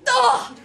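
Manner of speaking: short, high, very loud "ugh"; sharp shouted noise of disgust; high, explosive exclamation; loud scolding grunt. A narrator's reading. Roger slaps the boy's hand.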